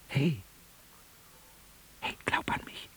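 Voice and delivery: a soft, fading whisper. Acted. Djinn leaving the sleeping prince, whispered farewell. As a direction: breathe it as the voice vanishes. Djinn fades away from the dreamer.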